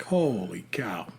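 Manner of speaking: low, near-whispered disbelief; hushed shock. Acted. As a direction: drop to an awed whisper. Imagined sudden sighting in cornfield.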